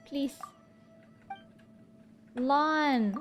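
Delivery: usual speaking voice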